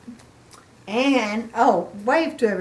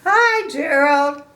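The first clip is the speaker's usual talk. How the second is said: cheerful pig voice; 6 semitones higher, 6 dB louder, slower than usual. Acted. Piggy greets Gerald.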